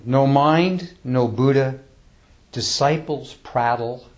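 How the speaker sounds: slow, measured recitation with long pauses; slow, reverent poem recital; poem reading. Acted. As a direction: read it like a sacred verse, unhurried. Recites another death poem.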